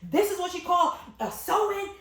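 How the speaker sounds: high, loud scornful question; mocking mother. Stylist imitating the mother's loud scorn, high and loud. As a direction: scoff at the sewing like the angry mom.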